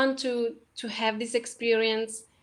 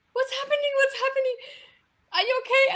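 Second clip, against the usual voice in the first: much higher, alarmed questions; worried partner; 12 semitones higher, faster than usual. Retold or imitated. Partner asking if she's okay, high and alarmed. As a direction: play the partner's alarm at the sobbing. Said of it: "my partner is just like".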